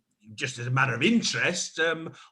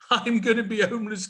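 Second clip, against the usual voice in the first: hammed-up wailing; 7 semitones higher, faster than usual. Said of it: "stop your wailing".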